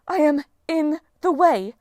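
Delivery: slow, high, loud, spaced words falling at the end; affronted goddess, drawn-out and imperious; indignant statement. Acted. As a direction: spell out the offence word by word. Outraged goddess straightens, repeats it.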